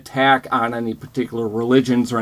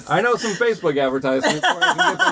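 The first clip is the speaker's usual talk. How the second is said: higher, fast and laughing; laughing joke; 9 semitones higher, much faster than usual. Real, not acted. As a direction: rattle it off through laughter. Laughing mock ad pitch.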